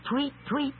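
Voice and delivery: ordinary talk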